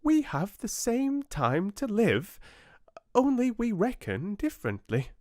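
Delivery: high, piping tiny fly voice; little fly. A narrator's reading. Tiny fly questions the oak, high piping voice. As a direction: squeak it out like a tiny insect. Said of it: "said the little fly".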